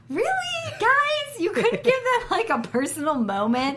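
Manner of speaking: incredulous, squeaky high pitch; really, you serious?; exasperated disbelief. Retold or imitated. Exasperated quote at crowd.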